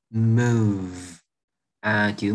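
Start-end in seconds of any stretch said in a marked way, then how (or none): 0.1-1.2 "move" drawn out into a long V; final V sound. Shown as a demonstration. Teacher demonstrating chữ move, long voiced V on "move". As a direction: hold the buzzing V at the end of "move". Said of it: "âm".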